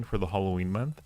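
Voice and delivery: ordinary talk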